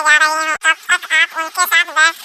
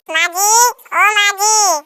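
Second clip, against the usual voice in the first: drawn-out, higher-pitched call; 4 semitones higher, slower than usual. Acted. Daughter-in-law calls her mother-in-law in the morning, calling out. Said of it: "गला फाड़ रही हो".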